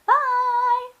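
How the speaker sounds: high, loud bright bye; cheery goodbye. Real, not acted. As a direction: sing out the farewell to viewers. Cheerful sign-off to viewers.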